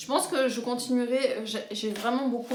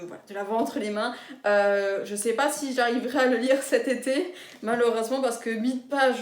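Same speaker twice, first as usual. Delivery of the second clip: ordinary delivery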